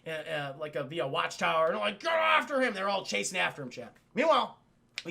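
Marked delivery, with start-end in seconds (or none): 2.0-2.7 higher, urgent yell; mock shouted order. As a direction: call out the chase like a guard. Acted guards calling a chase.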